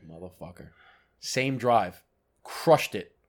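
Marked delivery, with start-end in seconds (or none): 0.0-0.7 low, soft muttered curse under the breath; a quiet groaned curse at the wind. Retold or imitated. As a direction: grumble it low, defeated.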